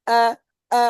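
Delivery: thin, narrow আ; thin vowel. Shown as a demonstration. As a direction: say আ light and narrow, not rounded. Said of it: "উচ্চারণটা পাতলা হবে, সরু হবে". (আ, পাতলা ও সরু করে)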